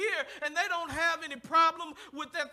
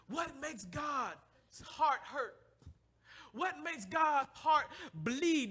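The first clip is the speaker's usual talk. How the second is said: drawn-out, aching question; slow, heavy sermon question; slow preaching; 4 dB quieter, much slower than usual. Acted. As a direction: stretch each word to the church.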